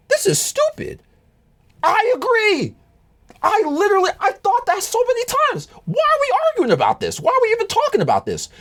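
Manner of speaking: very high, squeaky exasperated whine; high-pitched mock exasperation. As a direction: whine it way up in disbelief.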